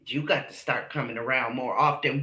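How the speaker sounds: low, soft sing-song quote of the mama; mimicking the mama. Mocking the mama's invitation, low and soft. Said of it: "mama gonna say to him".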